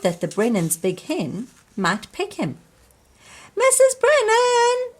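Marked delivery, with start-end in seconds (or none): 3.6-4.9 high, loud call for help; a child shouting for the neighbour, loud and high. A narrator's reading. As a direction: shout for the neighbour across the yard. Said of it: "shouted John Joe".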